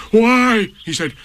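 suddenly thrilled with an idea; excited donkey. A narrator's reading. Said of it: "he became quite excited".

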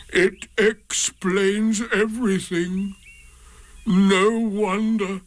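resigned and glum; gloomy. A narrator's reading. Eeyore to Pooh about his lost tail, slow and gloomy.

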